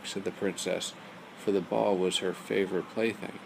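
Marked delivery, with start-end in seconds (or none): none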